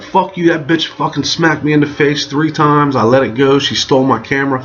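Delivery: high, indignant acted rant; heated protest. Storyteller defending himself to two dudes, acted indignation.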